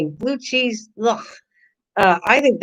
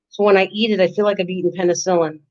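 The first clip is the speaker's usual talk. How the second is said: usual speaking voice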